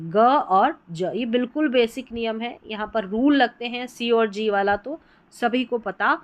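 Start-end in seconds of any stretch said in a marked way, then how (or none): 0.1-1.1 ग and ज drawn out very slowly, one by one; slow sound demo. Shown as a demonstration. Teacher slowly models the two sounds of G. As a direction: spell out ग और ज slowly for beginners. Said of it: "इसके दो उच्चारण होते हैं".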